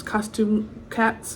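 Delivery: plain reading voice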